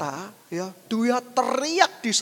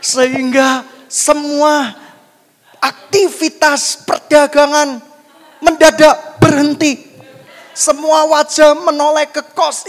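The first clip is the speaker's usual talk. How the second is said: loud, raised, dramatic storytelling; full-force preaching; 4 semitones higher, 10 dB louder than usual. Acted. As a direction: belt out each phrase like a big climax. Preacher tells climax at full force.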